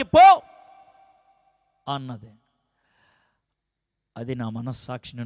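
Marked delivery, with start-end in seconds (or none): 0.0-0.4 a sudden loud, high bark of go; furious shout. Retold or imitated. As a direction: throw him out with one word. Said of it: "పీక నొక్కేసినట్లు నొక్కి".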